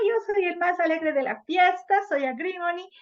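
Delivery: hammed-up fake joy; mock life of the party. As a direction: boast like the happiest one at the party. Playing the fake-happy agrimony type.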